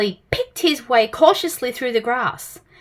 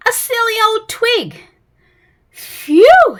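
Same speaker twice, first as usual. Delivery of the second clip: high, loud relieved phew; 10 semitones higher, 8 dB louder, slower than usual. Acted. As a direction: laugh off the silly twig. Mister Jelly relieved.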